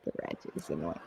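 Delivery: whispered scornful aside. Real, not acted. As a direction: mutter it under your breath. Muttered aside after laughing.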